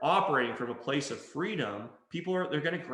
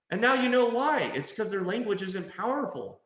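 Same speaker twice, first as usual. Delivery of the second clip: ordinary delivery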